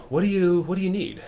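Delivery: ordinary talk, nothing marked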